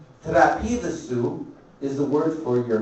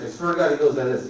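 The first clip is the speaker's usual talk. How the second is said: normal talking voice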